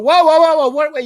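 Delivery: high, loud startled whoas. Retold or imitated. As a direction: stop her dead in surprise. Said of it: "I said".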